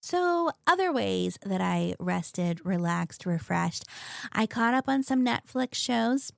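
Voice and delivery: normal talking voice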